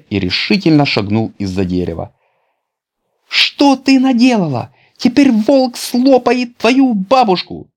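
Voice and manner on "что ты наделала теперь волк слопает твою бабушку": put-on high, loud, slow boyish alarm; a boy crying out in dismay. A narrator's reading. (Петя в тревоге кричит Шапочке, высоким громким голосом)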